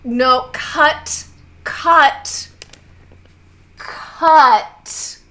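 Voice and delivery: calling out cut. Acted. Clipboard lady halting a crowd of players, shouted. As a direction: shout each word to halt the room.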